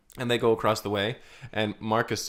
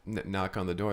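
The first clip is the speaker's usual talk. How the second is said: usual speaking voice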